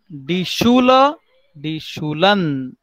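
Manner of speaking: slow: Schule with sh, Schulen with -n; teacher modelling the plural; model pronunciation. Shown as a demonstration. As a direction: draw out die Schule, die Schulen. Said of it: "teacher models plural".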